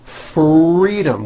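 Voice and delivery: FREEDOM with a strong, drawn-out R. Shown as a demonstration. Teacher modelling practice word, strong R in FREEDOM. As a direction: linger on the R in FREEDOM, slowly. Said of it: "with a strong R".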